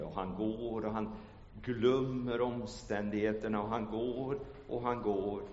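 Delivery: normal talking voice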